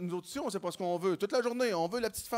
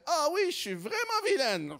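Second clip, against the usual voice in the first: put-on higher, coy naughty-girl voice, lightly done; mock naughty girl; 8 semitones higher than usual. Girlfriend answering him in a lightly coy naughty voice. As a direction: play up being naughty, a touch breathy. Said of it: "Puis là, elle fait".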